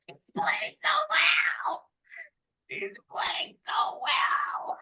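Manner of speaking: hammed-up tearful whimper; mock whimper. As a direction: whine it like a crybaby. Mocking fans, whiny fake cry.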